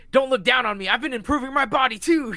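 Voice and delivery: high and loud, fired up; a con man proving he can fight; defiant shout. Retold or imitated. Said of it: "he's like".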